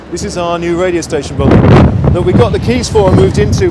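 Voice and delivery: loud outdoor voice in wind; shouting over wind. Real, not acted. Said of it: "I'm sorry about the wind noise".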